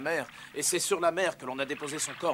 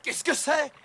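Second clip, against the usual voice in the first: startled cry; 13 semitones higher, 6 dB louder, slower than usual. Acted. Startled by noise in fog.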